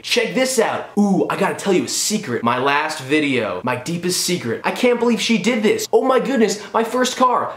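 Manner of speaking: hammed-up vlogger hype, swooping high; mock YouTube clickbait. Reviewer parodying YouTube titles, hammed-up clickbait voice. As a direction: tease secrets like a clickbait title. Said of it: "basically just YouTube clickbait".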